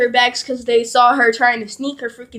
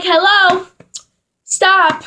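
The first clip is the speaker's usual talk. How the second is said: calling out; 6 semitones higher, slower than usual. Retold or imitated. Sister calling to stop cop car.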